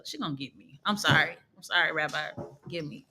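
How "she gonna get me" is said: muttered aside. Real, not acted. Mock worry aside.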